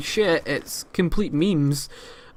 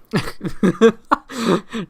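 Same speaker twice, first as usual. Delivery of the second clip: real burst of amused laughter; 6 semitones higher than usual. Real, not acted. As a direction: laugh at a hopelessly wrong guess. Laughs at kid's wrong guess.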